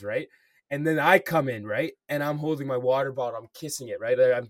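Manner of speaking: ordinary talk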